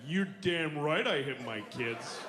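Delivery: low, slow defiant boast; mock proud parent. Parent bragging about hitting, low and slow. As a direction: boast like a proud tough dad.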